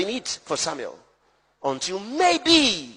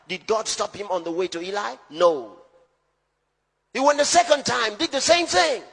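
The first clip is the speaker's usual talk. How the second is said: ordinary talk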